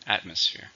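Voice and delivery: "Atmosphere" with the first syllable stressed. Shown as a demonstration. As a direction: say "Atmosphere" stressing AT, the rest lighter. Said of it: "stress on the first".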